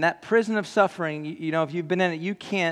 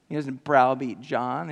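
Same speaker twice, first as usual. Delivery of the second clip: usual speaking voice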